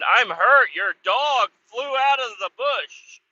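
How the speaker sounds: high, slow mock whine of a hurt kid; a child's high wounded whine, slowed; hurt complaint. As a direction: whimper it out to the dog's owner. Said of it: "I said".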